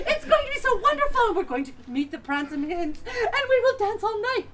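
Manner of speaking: high, loud and laughing through it; giddy stepsister chatter; giggling sisters. Acted. Sisters excited about ball.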